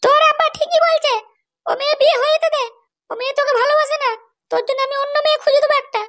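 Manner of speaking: mother talking her son out of it, pitched high. Acted. Mother telling her son to let the girl go, high coaxing voice.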